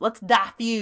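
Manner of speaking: Southern twang, DIFFUSE as "DAH-fyooz"; Southern-accented "dah-fuse". Shown as a demonstration. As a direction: say it like a Southern belle. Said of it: "However, when it's us".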